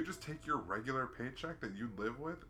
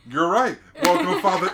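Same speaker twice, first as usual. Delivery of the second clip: joyful welcome; 9 semitones higher, 16 dB louder than usual. Retold or imitated. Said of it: "And he's like".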